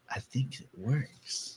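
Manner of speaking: slow, soft put-on voice; imitated tentative remark. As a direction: draw it out softly, imitating her. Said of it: "she's all like".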